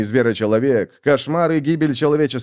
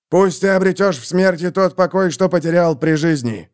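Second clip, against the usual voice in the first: solemn farewell; 6 semitones higher than usual. Acted.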